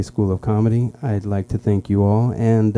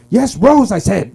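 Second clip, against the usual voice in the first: high, loud impatient snap; mock an exasperated lady passenger; irritated passenger; 10 semitones higher, 4 dB louder, slower than usual. Lady passenger to confused driver, irritated.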